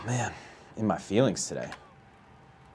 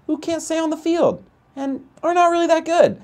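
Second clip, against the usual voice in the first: high, loud outraged disbelief; Jerry Seinfeld outraged voice; 10 semitones higher, 3 dB louder than usual. Real, not acted. Gamer ranting to chat about the Angels, high outraged voice. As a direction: pitch way up in mock outrage at the Angels. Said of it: "I got, like, a Jerry Seinfeld outraged voice right now".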